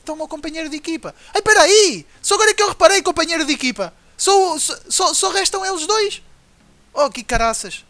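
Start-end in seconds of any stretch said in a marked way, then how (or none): 1.3-6.2 high, loud surprised outburst; sudden realization. Real, not acted. Gamer realizing only two enemies remain, surprised. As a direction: gasp as it dawns on you.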